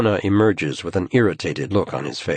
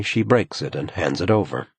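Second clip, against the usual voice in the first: ordinary delivery, nothing marked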